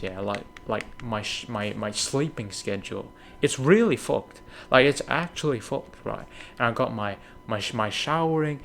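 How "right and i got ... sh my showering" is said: usual speaking voice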